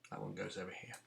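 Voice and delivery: soft unvoiced whisper. Real, not acted. Teacher to class, whispered aside. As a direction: whisper it to yourself.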